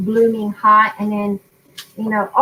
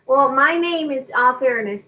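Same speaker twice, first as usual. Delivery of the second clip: ordinary talk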